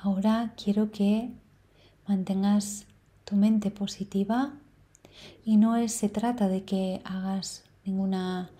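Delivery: soft meditation voice with long, unhurried pauses; guided meditation. Real, not acted. Meditation guide leading listeners through breathing and number sequence, calm guiding voice. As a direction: guide them gently, leaving space to breathe between phrases.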